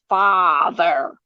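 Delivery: slow "father" with a precise, drawn-out first vowel; careful, unhurried vowels on "father"; precise Kennedy vowel. Shown as a demonstration. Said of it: "make your vowels precise".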